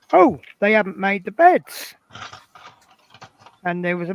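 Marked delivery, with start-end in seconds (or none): 0.1-2.0 mock surprise. Acts out first comment entering room.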